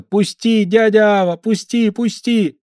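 put-on high, loud pleading boy's voice; frightened child. A narrator's reading. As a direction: beg to be let go like a scared kid. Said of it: "закричал Федька".

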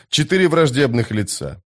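ordinary delivery, nothing marked

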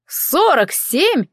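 drawn-out, high-pitched shocked question; astonished exclamation. A narrator's reading. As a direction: echo it slowly in disbelief, pitch leaping up. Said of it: "вскричал эльф".

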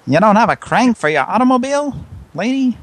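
put-on fast, high old-time radio voice; 1930s voice. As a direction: rattle it off like a 1930s movie. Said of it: "I'm talking in a nineteen thirties voice".

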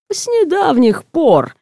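languid, in no rush at all; lazy, unhurried. A narrator's reading. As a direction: stretch it out lazily. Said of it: "в своей ленивой неспешной манере отвечает Крейг".

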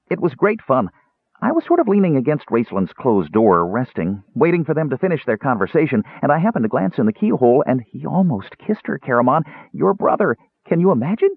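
high, eager kender chatter; gleeful gossip. A narrator's reading. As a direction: spill the scandal to your friend. Kender gleefully tells keyhole gossip.

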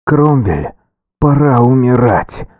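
muffled growl from inside armor; echoing voice inside helmet. A narrator's reading. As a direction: growl it, hollow and boomy. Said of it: "прорычал глухой голос".

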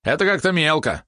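high, sniffy refusal; disdainful. A narrator's reading. Valeron finds the offered candy too petty, high disdain. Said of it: "сморщил Валерон нос".

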